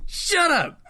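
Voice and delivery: squealed laughing yell; laughing shout. Real, not acted. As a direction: yell it while cracking up.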